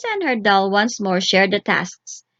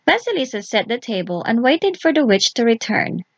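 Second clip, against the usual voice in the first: ordinary delivery, nothing marked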